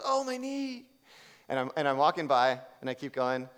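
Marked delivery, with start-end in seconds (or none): 0.0-0.9 high imitated oh my knee; woman's pained cry. Retelling the woman's complaint to her husband, high mock wince.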